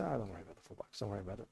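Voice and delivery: very soft, low retold brush-off; casual reassurance. Cafe owner waving off money for a coffee, casually reassuring. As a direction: quietly imitate someone waving away a small debt.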